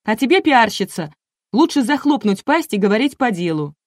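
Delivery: громко и высоко, с раздражением; грубо осадить пиарщицу; злая отповедь. A narrator's reading. Энн грубо затыкает рассказчицу. (раздражённо, повышенным голосом)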